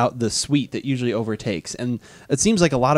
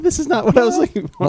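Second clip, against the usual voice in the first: put-on disappointed kid; 10 semitones higher than usual. Kids' reaction voiced.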